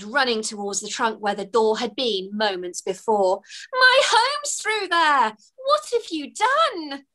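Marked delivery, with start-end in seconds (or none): 3.7-7.1 much higher, slow and pleading; high, slow, desperate cry; distraught. Acted. Fairy wailing at the stranger who closed her door.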